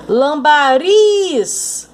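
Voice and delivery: Lambariz drawn out slowly, riz loud and high; stressed last syllable. Shown as a demonstration. Teacher to students, Lambariz slowly with riz stressed. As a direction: stretch Lambariz and punch the riz. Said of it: "sílaba tônica é uris".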